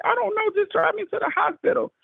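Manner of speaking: high, urgent begging to be driven; pleading high and anxious; retold urgent plea. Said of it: "I was like".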